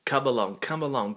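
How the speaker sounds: usual speaking voice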